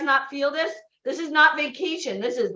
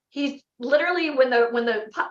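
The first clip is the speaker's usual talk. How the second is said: ordinary delivery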